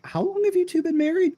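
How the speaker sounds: high, incredulous question; amazed question. Retold or imitated. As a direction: ask it in high disbelief. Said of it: "I'm like".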